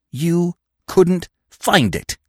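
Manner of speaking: slow disbelieving repeat; incredulous, slow. Acted.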